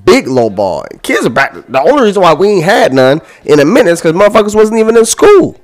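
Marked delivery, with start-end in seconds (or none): 1.5-5.6 climbing higher and louder to a yelled school; rising rant. Real, not acted. Host ranting about school shootings, rising to a yell. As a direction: build to an outraged yell.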